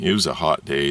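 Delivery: normal talking voice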